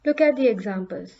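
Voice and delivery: ordinary delivery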